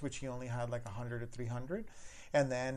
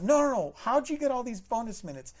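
much louder and higher, refusing to drop it; loud, high insistent repeat; insistent manager; 9 semitones higher, 8 dB louder than usual. Retold or imitated. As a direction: hammer the question home. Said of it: "manager repeats question".

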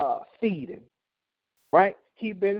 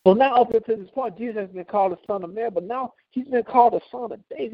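ordinary delivery

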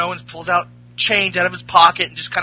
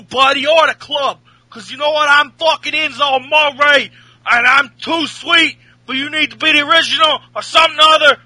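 higher, louder brash New York trash talk; Enzo promo voice; 7 semitones higher, 4 dB louder than usual. Retold or imitated. Host imitating Enzo's promo at A J, brash trash talk. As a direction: run your mouth like a cocky wrestler. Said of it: "Enzo says".

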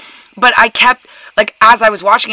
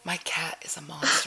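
unvoiced, soft aside whisper; 3 semitones lower, 18 dB quieter than usual. Real, not acted. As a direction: whisper it so the cat won't hear. Aside while cat jumps on table.

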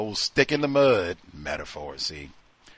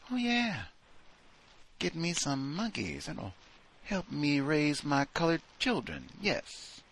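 high, soft breathy mocking wife; sing-song parody of a clueless wife; 7 semitones higher, 5 dB quieter than usual. Wife cooing about monkeys for her children, high and soft.

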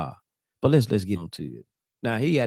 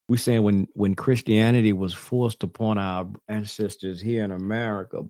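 usual speaking voice

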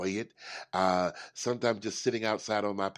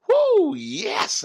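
high, loud burst of energy; refreshed, celebratory cheer; exuberant whoop; 10 dB louder than usual. Acted. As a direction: whoop it out, raring to go. Acts waking refreshed from a nap.

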